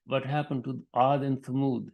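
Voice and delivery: ordinary talk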